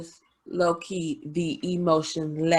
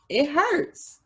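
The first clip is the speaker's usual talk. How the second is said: high-pitched little hurt voice; acted hurt; 9 semitones higher than usual. Admitting the estranged brother matters now, acted hurt. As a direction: voice jumps up, mock-wounded. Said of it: "I'm more emotional".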